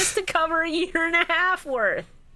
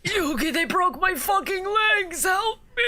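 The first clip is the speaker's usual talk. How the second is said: put-on higher, slow pleading old man; hurt grandpa plea; 5 semitones higher than usual. Grandpa asking Yugi for help, slow high plea. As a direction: drag it out like a battered grandpa.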